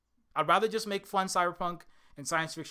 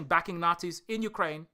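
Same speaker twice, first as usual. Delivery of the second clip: normal talking voice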